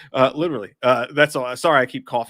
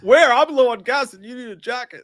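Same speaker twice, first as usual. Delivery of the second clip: high, loud excited movie quote; 11 semitones higher, 10 dB louder than usual. Retold or imitated. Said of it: "The whole Foxy Lady thing".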